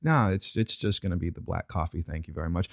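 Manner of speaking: fading out, just want coffee; drained customer. Retold or imitated. Customer wearily declines the scone, soft and low.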